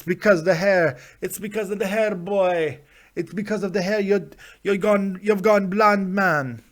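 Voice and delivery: hammed-up Jamaican patois; mock Jamaican accent. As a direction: carry on in a put-on Jamaican accent. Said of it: "I don't know why I'm going Jamaican".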